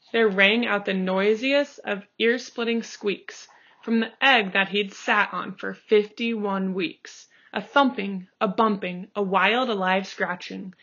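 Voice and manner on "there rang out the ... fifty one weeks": plain reading voice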